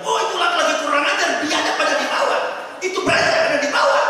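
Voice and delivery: loud, high angry yell from above; yelling wife. Retold or imitated. Wife yelling at the man downstairs, loud and high. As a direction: shout down at the man below.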